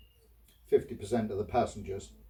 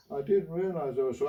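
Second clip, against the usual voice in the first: higher mock-polite shopkeeper voice; apologetic shopkeeper; 4 semitones higher than usual. Shopkeeper apologising to the customer, higher, meek voice. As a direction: play the shopkeeper grovelling a little, pitched up. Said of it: "he said".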